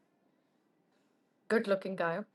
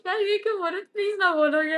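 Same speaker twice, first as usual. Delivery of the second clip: high, loud laughing plea; giggly witch-like plea; laughing witch reaction; 9 semitones higher, 6 dB louder, faster than usual. Retold or imitated. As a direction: cackle and beg like a possessed girl. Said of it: "मेरे अंदर चुड़ैल आ गई".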